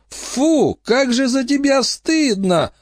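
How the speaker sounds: high embarrassed groan. A narrator's reading. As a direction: moan at her brother's fawning. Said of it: "простонала Эмма". (стон стыда)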